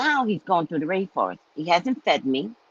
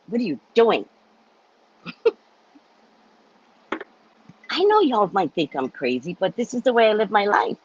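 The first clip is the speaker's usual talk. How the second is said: ordinary delivery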